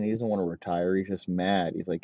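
ordinary talk

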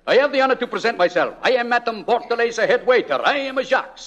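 a hammed-up French head waiter accent; a thin French accent from a man posing as a waiter; mock French waiter.

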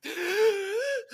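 a sharp, high gasp for air; gasp surfacing. Retold or imitated. Zoe coming up from the pool, gasping for air. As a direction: gasp as if bursting up out of water. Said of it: "and she's like".

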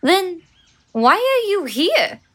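ordinary delivery

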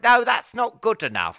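retold indignant protest; indignant refusal. Acted quote of own objection.

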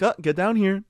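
high-pitched call to a pet; mock pet owner. Owner calling a pet spider off the wall, playful.

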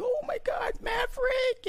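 very high falsetto mock squeal; imitating excited wife. Husband mimics wife watching old westerns, mock excited squeal. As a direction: squeal like a starstruck fan, hammed up. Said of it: "she get all excited".

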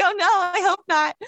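high-pitched retold "oh no"; a touch of embarrassed dread, pitched high; mock dismay. Actress retelling her embarrassment at being overheard, mock dismay.

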